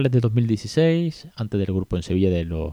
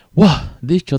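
a loud, higher burst of relief; exasperated exclamation; 7 semitones higher, 6 dB louder, slower than usual. Real, not acted. Podcast host to listeners after a long intro, loud relieved burst. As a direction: blow out the breath after a long list of announcements.

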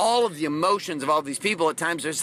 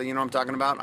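ordinary delivery

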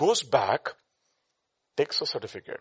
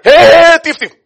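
high, very loud "stop, thief" yell; accusing shout; 25 dB louder, slower than usual. Acted. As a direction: holler after a shop thief. Acts out supermarket accuser shouting thief.

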